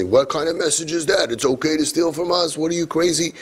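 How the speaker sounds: lightly put-on scandalized therapist; hint of an appalled therapist; therapy voice. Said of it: "That's my therapy voice".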